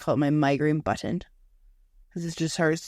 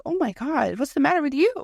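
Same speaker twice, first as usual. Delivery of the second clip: mock alarm; 9 semitones higher than usual. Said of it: "I was like".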